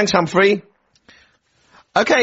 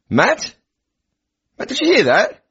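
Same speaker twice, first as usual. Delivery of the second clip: normal talking voice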